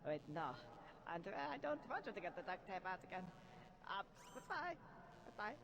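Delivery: put-on German accent. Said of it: "pretty bad German accent".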